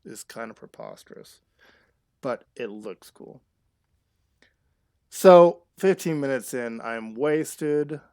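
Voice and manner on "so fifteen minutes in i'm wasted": loud announcement. Real, not acted. Streamer announcing her cat is wasted, loud and gleeful. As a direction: proclaim it to chat, much louder.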